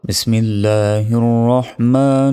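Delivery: slow, melodic tajweed with long held notes; sustained, unhurried sacred chant; Quran recitation. Shown as a demonstration. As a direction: chant the sura slowly, drawing out every long vowel. Recites the whole sura.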